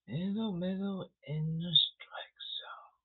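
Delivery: hushed unvoiced whisper, slow. Explaining the strike zone to viewers, in a slow whisper. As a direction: breathe it out like a secret.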